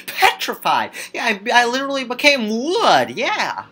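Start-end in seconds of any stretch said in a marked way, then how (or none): none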